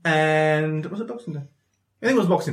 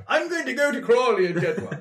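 excited; 7 semitones higher than usual. Retold or imitated. Acting out his resolve to go shopping.

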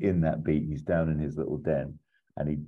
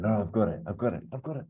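higher, eager repeats of got it; excited; 5 semitones higher, faster than usual. Retold or imitated. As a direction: grab the idea, a bit giddy. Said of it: "Oh, he's really excited".

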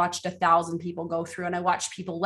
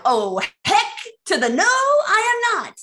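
mock panicked refusal; 11 semitones higher, 4 dB louder than usual. Retold refusal to the Lord.

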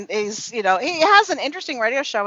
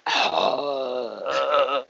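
long, low, soft sigh; drawn-out dreamy sigh; swooning sigh; 9 semitones lower, 5 dB quieter than usual. Real, not acted. Swooning over narrator of her books.

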